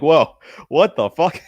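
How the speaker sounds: loud, high, drawn-out disbelief; retold shock. Retelling his reaction to Discord deleting a server, shocked. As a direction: act out being stunned, stretching the words.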